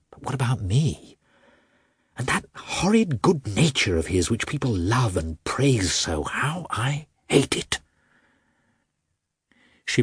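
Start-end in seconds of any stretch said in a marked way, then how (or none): 0.1-7.8 bitter resentment, pitch lifting; pent-up resentment, slowing on the last words; resentful inner outburst. A narrator's reading. Wife thinks of her husband's good nature, resentful.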